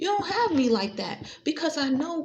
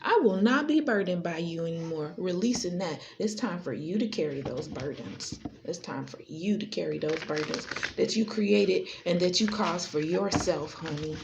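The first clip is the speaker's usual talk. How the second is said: calm release; 6 semitones lower than usual. Acted. Releasing burdens ritually.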